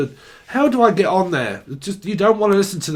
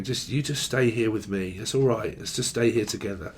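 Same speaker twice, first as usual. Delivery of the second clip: soft clingy plea; 6 semitones lower, 8 dB quieter than usual. Acted. Tender clinging lover voice.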